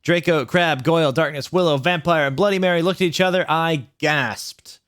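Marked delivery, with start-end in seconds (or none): none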